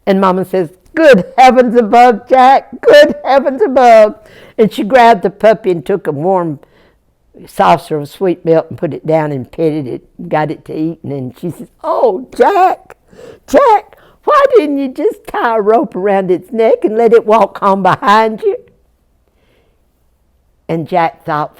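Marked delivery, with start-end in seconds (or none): 0.9-4.1 loud, high dismayed cry; raised, exasperated exclamation. Acted. Mama exclaims at dripping dog. 11.8-18.6 exasperated mother. Acted. Mama exasperated with Jack.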